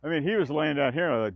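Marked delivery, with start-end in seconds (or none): none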